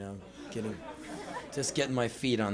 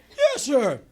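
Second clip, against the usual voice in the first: high, loud, overeager blurt; terrified agreement; 12 semitones higher, 6 dB louder than usual. Retold or imitated. Terrified boy agreeing to go outside, high blurt. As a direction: agree in a panicky squeak. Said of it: "I'm terrified".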